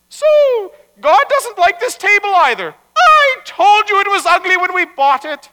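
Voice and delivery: high, loud nagging retort; scolding wife. Acted. Wife complaining to rabbi about the ugly table, scolding. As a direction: score the point in a high, loud voice.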